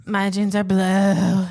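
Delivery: slow, breathy mock sultry read; imitating ad voice. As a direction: parody the ad's slow seductive delivery. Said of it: "Why does she talk like that?".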